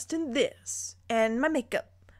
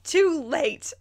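loud, higher cutting-off shout; angry yell; 6 semitones higher, 5 dB louder than usual. A narrator's reading. Alexis cutting off Harry's apology, loud angry shout. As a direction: slam the door on his apology, loud. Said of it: "I yell".

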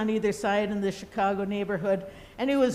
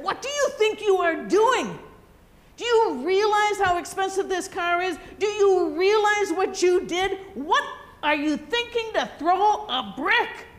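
put-on loud, high furious scolding of a child; angry scolding; 8 semitones higher, 4 dB louder than usual. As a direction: tear into the kid over the car. [name] scolds kid over dented car.

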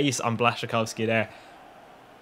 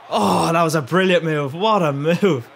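excited; 4 semitones higher, 4 dB louder than usual. Real, not acted. Excited by brilliant move.

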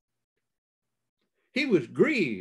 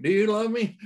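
higher-pitched, pressing question; insistent questioning; 6 semitones higher, 3 dB louder, faster than usual. Retold or imitated. Preacher retelling Jesus questioning Peter a third time, insistent.

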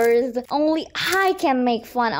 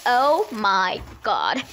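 ordinary delivery

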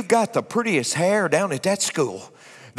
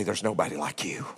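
tender; 11 semitones lower, 9 dB quieter than usual. Retold or imitated. Quoting a woman's tender words.

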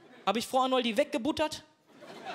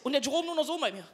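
normal talking voice

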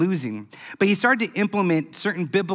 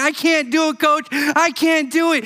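mock crying plea; 9 semitones higher, 3 dB louder than usual. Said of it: "Brock now crying".

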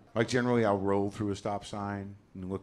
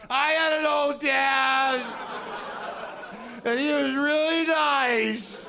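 much higher, louder, slow wailing sob voice; crying woman; 8 dB louder, much slower than usual. Retold or imitated. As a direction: wail it like a sobbing fan. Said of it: "a woman has come up to me after a show crying".

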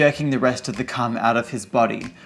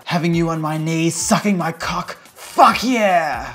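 higher-pitched, lusty moan; performed moan rising in excitement; 4 semitones higher than usual. A narrator's reading. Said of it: "Syndrome moaned".

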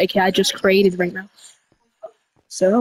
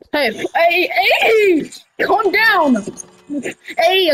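raised, high hey to stop them; calling to calm someone; 6 semitones higher, much slower than usual. Acted. Friend calling out to calm a panicking friend. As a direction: cut in high and insistent.

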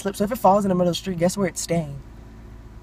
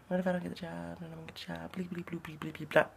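mumbling her own worries under her breath, much softer; a worried inner mumble trailing into nonsense; muttered anxious babble; mumbled worry; 16 dB quieter than usual. Retold or imitated. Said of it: "I was like".

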